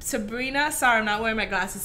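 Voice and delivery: ordinary talk, nothing marked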